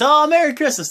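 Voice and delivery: very high, loud gushing Merry Christmas; hammed-up cheery greeting. Jason Jordan greeting everyone, hammed-up and very high. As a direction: gush the greeting like an overexcited guest. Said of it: "comes in and says".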